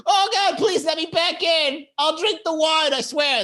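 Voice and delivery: high, hammed-up begging; mock crying plea. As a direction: beg to be let back in with fake wailing. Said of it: "Will he call me crying?".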